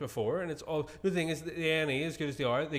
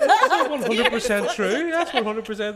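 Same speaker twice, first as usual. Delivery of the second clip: laughing insistence; 7 semitones higher, 8 dB louder, slower than usual. Real, not acted. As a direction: insist through laughter, high and slow.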